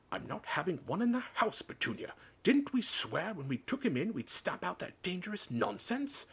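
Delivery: high-pitched, emphatic outrage; heated, insistent refusal; indignant. A narrator's reading. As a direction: lay down the law to your wife. Uncle indignantly refuses, arguing with wife.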